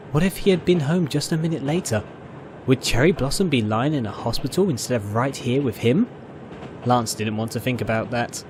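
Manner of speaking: ordinary delivery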